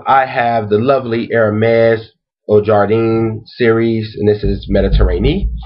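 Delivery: slow list item, rising at the end; measured, deliberate recap; slow repeat. Real, not acted. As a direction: spell out the name at leisure. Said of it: "let me repeat it slowly".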